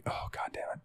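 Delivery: whispered under the breath; barely voiced, resigned groan to yourself; hushed private curse as the boss begins; dreading aside. Retold dread at boss's news.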